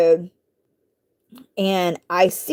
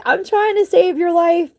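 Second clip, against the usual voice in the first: higher, strained pleading; exasperated plea; 9 semitones higher than usual. Retold or imitated. Woman rescuing a biting kitten, strained plea. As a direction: plead hard, no laughter now.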